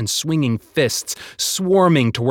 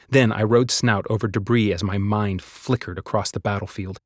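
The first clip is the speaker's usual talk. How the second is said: ordinary delivery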